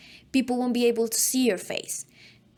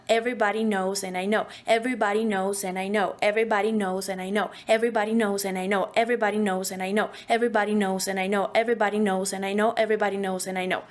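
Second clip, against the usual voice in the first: rapid repeated phrase; fast as you can; faster than usual. Shown as a demonstration. Voice coach speeding up the phrase as fast as possible. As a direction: rattle it off faster and faster. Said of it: "as fast as we can".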